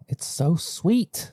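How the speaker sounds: high, slow and dreamy; savoring the sweetness. Real, not acted. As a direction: linger on every word.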